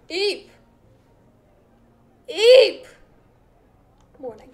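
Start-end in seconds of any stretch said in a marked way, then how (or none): 0.1-2.8 startled eep. Real, not acted. Startled squeak at game scene.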